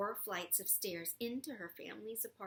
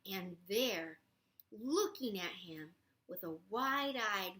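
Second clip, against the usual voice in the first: ordinary delivery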